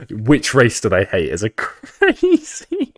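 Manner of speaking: laughing through the words, slow. Real, not acted. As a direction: giggle while reading the question out. Laughing at a chat question.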